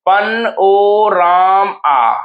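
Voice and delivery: very slow पन ओ राम आ with gaps; syllable by syllable. Shown as a demonstration. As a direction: stretch and separate पन, ओ, राम, आ. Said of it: "देखिए तोड़ के लिखिए".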